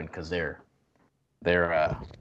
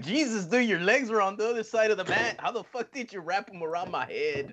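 mock amazed; 10 semitones higher than usual. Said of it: "I'm like".

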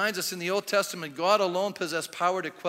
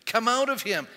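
firm order, slightly raised; stern command; 5 semitones higher, 6 dB louder than usual. Acted. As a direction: cast it out sternly. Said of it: "he said sternly".

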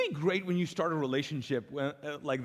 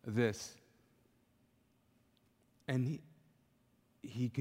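normal talking voice

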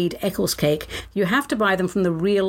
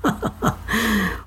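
laughing; 2 semitones higher than usual. Real, not acted. Breaks up laughing mid-poem.

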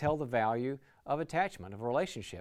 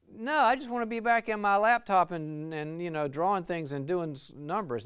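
higher, louder whiny team member wanting back to his laptop; reluctant employee; 6 semitones higher, 6 dB louder than usual. Acted. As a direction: play a grumbling introvert who'd rather crunch numbers. Reluctant employee voiced by teacher.

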